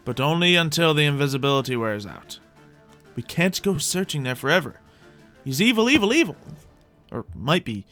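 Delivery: usual speaking voice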